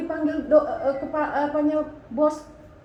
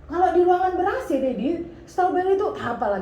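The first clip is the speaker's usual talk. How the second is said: anak membela diri; 6 semitones higher, 6 dB louder than usual. Retold or imitated. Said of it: "gitu kan".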